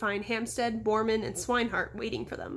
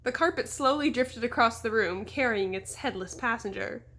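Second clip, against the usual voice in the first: ordinary delivery, nothing marked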